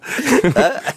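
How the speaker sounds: real laughter breaking out; giggling at the joke; high laughter. Real, not acted. Laughing at guest's story.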